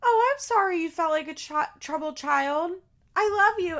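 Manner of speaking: high, loud, syrupy fake-sweet voice; mock sugary apology. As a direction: mimic a dismissive, saccharine brush-off. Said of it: "And Angie's like".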